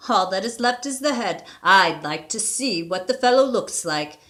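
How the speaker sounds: plain reading voice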